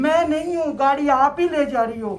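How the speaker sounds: emphatic devotional cry, retold at full voice; fervent retold plea. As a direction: call out to the goddess with conviction. Retells fervent plea to goddess.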